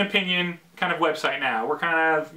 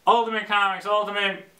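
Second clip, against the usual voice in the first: ordinary talk, nothing marked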